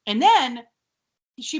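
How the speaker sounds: ordinary talk, nothing marked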